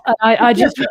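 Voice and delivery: ordinary delivery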